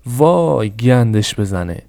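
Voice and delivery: ordinary delivery, nothing marked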